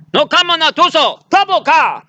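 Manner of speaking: hammed-up squeal rallying the tribe; mock panicked shouting, very high; frantic cry. Acting tribe chief's cry.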